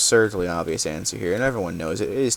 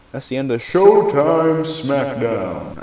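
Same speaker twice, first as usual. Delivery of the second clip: slow, louder, drawn-out show-title announcement; announcer voice; 4 semitones higher, 6 dB louder, slower than usual. Acted. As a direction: boom the title like a wrestling announcer. Closing the show's segment.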